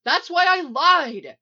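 high, loud, drawn-out shout; heated, straining confession; shouting back. A narrator's reading. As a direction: yell the justification defensively. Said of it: "Barry shouts back".

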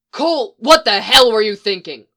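angry yell of worry. A narrator's reading. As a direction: shout it in his face. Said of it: "Phil yelled".

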